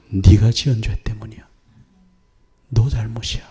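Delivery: a hushed divine rebuke; God's whisper. Retold or imitated. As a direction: whisper the truth solemnly.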